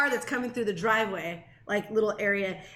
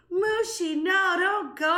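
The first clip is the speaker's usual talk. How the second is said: put-on timid kid plea; 7 semitones higher, 3 dB louder, slower than usual.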